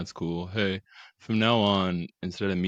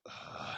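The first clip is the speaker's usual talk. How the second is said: disgusted groan; 16 dB quieter than usual. Retold or imitated.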